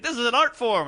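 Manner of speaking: high, loud, self-important protest; mock your own precious-artist outburst; real pretentious.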